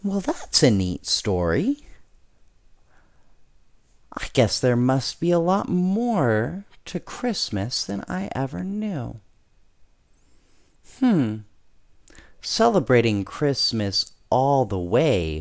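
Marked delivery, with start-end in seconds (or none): none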